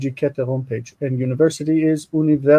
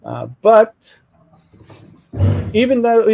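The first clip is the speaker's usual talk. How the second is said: normal talking voice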